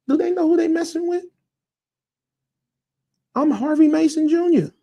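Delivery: high-pitched mock disbelief; incredulous outrage. Acting out Harvey's outraged reaction.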